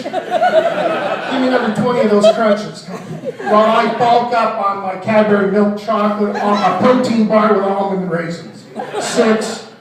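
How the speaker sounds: loud trainer calling out reps; personal trainer. Acted. Comic as trainer ordering crunches, loud and commanding. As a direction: drive the class through the set, loudly. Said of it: "I'm gonna be a personal trainer".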